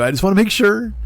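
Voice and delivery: a little squeak of a check-in; high-pitched reassurance. Retold or imitated. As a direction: pitch it way up, overly polite. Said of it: "I go".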